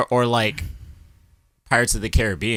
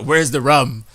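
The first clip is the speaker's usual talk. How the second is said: Jack Sparrow; 5 semitones higher, 5 dB louder, slower than usual. Retold or imitated. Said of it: "You got that Jack Sparrow".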